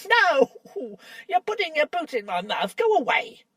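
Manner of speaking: dwarf yelling at a boot in his mouth; indignant yell. Acted. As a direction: shove him off with a cry. Dwarf trodden on in dark.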